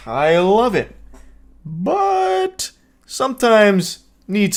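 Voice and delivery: high, slow smarmy creep voice; creep impression. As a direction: ham up the sleazy pickup speech. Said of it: "he continues with his speech".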